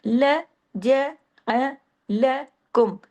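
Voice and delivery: ला जा अलकुम very slowly, a pause after each syllable. Shown as a demonstration. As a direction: spell out ला जा अलकुम beat by beat. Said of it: "कैसे?". (ला जा अलकुम को टुकड़ों में, रुक-रुक कर)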